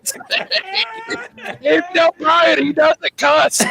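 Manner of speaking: real laughter lifting the voice high; talking through laughter. Real, not acted. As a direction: crack up while telling it. Cracks up telling the story.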